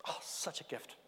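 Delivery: soft breathy whisper, almost no voice; whispered aside. Real, not acted. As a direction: breathe it as a private, fond aside. Tender aside about the kids.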